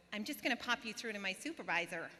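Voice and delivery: loud American receptionist patter; fake American accent. Call-centre worker transferring a caller, put-on American accent. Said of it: "talking in this American accent".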